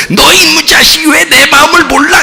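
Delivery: high, loud mock shout of a fed-up parent; hammed-up angry parent; mock scolding. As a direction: shout at the child who doesn't understand.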